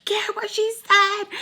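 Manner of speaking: high, loud imitated angry outburst; retold furious dismissal; mad yell. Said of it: "so mad".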